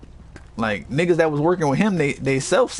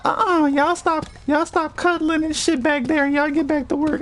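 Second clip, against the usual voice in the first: Jordan fussing; 12 semitones higher than usual. Retold or imitated. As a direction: fuss at coworkers in a high put-on voice. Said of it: "used to stay fussing".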